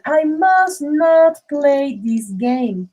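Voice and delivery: slow, deliberate model sentence; slow teacher model reading; slow demo. Shown as a demonstration. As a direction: drag it out for the students. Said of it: "And the last one".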